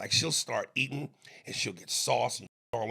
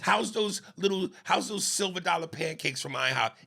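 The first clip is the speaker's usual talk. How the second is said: higher-pitched toddler-style mockery; mock childlike talk; 6 semitones higher than usual. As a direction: mimic a grown-up talking like a little child. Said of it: "You talk like a child".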